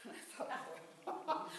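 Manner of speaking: laughing. Real, not acted. Laughing while retelling a call.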